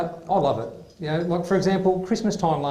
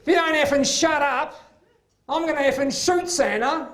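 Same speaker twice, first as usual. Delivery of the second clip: stressed mum snapping; 10 semitones higher than usual. Retold or imitated. Stressed mother threatening her kids at Christmas, high fed-up snap. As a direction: play the frazzled mother who's had enough. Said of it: "these words came out of her mouth".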